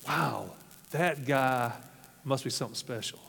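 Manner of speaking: soft, low, falling away in wonder; hushed awe. Retold or imitated. Blind man marvels to himself about Jesus, hushed awe. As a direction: breathe it as a quiet inner thought. Bartimaeus' imagined awed thought.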